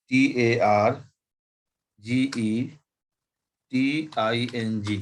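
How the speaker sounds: targeting spelled letter by letter as T A R G E T I N G; spelling out letters. Shown as a demonstration. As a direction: spell each letter clearly. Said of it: "spelling word for students". (अक्षर अक्षर बोलकर spelling)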